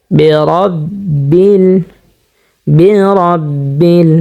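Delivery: বেরব্বিল drawn out very slowly with a thick, full র; heavy full r. Shown as a demonstration. Teacher showing viewers the heavy r, slow বেরব্বিল. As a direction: stretch বেরব্বিল and make the র heavy. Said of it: "রটা আমরা মোটা করিয়া পুর করে পড়ব".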